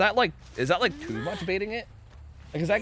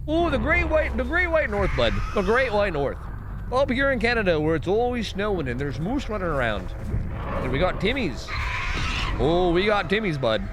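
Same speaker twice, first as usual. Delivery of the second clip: put-on Canadian accent; 3 semitones higher than usual. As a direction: play the proud Canadian, eh. Mock Canadian voice about Canada.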